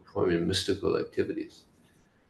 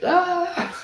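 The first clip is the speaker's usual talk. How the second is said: very high, drawn-out mock shriek; shocked scream; 3 dB louder than usual. Gopi hears Krishna's threat to marry her, shocked shriek. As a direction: let out a startled, high "aah".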